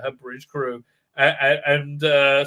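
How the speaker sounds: usual speaking voice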